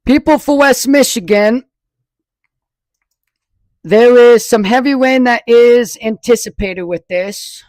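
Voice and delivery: loud, pushed broadcast voice; screaming into mic. Real, not acted. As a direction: project hard like a storm report. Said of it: "I am still screaming in the dang microphone".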